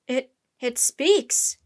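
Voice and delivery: loud, slow, halting amazement; shouting. A narrator's reading. Sailor hears the octopus talk, shouting in shock. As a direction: stammer out a stunned shout. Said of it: "shouted a sailor".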